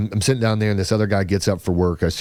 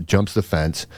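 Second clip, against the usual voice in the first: ordinary talk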